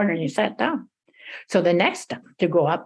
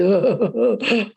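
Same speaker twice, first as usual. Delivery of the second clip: sly chuckle. Acted. Hungry troll chuckles.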